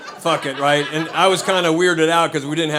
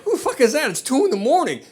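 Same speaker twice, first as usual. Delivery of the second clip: high-pitched startled demand; indignant, higher-pitched mock alarm; alarmed disbelief; 8 semitones higher than usual. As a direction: act out being startled by a late-night knock. Acts startled reaction to late knocking.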